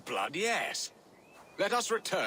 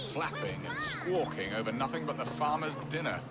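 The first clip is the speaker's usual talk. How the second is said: ordinary talk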